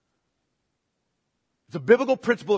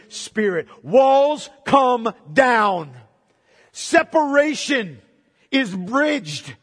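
slow, weighty phrases with long pauses; solemn emphatic preaching; 2 semitones higher, 4 dB louder, slower than usual. Real, not acted. Preacher proclaiming the gospel to the congregation, slow and emphatic. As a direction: land each phrase deliberately, like a proclamation.

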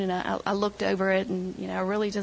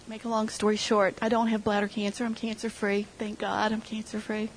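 ordinary talk, nothing marked

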